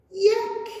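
high, loud disgusted yuck; a puppy grossed out by a bug. Acted. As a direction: spit it out like something tastes awful. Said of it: "said Rusty".

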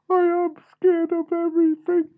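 very high, loud, squeaky confession; timid little squeak, pushed right up high; scared mouse. Acted. Freddy Mouse admitting his fear to his brothers, scared. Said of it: "Freddy Mouse said".

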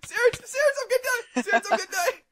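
very high squeal with laughter in it; high-pitched, giggly panic; panicked pleading. Real, not acted. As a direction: squeal for your teammate's rescue, half laughing. Begging teammate while about to die.

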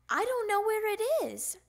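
high, loud complaint; little frog at a loss; childish protest. A narrator's reading. Child protests about lost cards.

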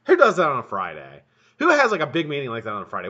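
loud, fast indignant complaint; exasperated rant. Real, not acted. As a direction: vent about the boss's meeting. Ranting about a Friday meeting.

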